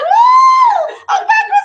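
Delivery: high, loud excited shout; hollering. Acted. Ladies following Shango, hollering with excitement. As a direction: holler like thrilled fans. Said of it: "the ladies just hollering".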